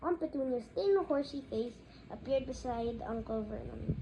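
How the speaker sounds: usual speaking voice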